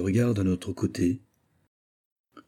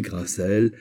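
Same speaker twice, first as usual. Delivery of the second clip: usual speaking voice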